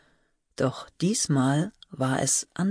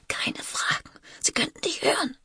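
high, urgent whispered warning; fearful whisper; 12 semitones higher, 4 dB quieter than usual. A narrator's reading. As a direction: whisper as if someone might hear. Sister fears being overheard. (angstvoll geflüsterte Warnung)

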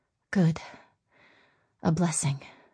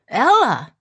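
calling out; 6 semitones higher, 7 dB louder than usual. A narrator's reading. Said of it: "A husky voice hailed her".

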